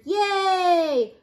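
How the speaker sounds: high, drawn-out cheer. A narrator's reading. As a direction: cheer like a whole crowd. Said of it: "the crowd cheered".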